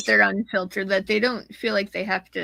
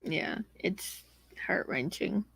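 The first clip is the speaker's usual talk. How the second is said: a soft, breathy whisper, much softer; real hushed whisper; 2 semitones lower, 10 dB quieter than usual. Real, not acted. Listener answering a story of abuse in a hushed whisper. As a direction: drop to a near-whisper out of sympathy. Sad topic, hushed reply.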